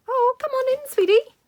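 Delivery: high, loud sing-song coo to the dog; doggy talk. Real, not acted. Calling the dog in.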